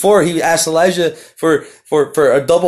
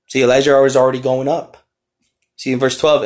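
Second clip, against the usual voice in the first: ordinary delivery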